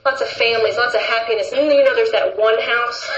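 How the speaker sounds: ordinary talk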